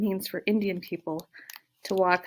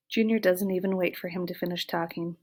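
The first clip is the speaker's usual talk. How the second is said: ordinary delivery, nothing marked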